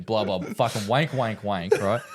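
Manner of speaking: usual speaking voice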